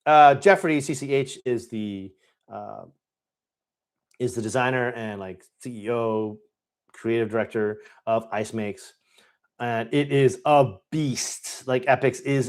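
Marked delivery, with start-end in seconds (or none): none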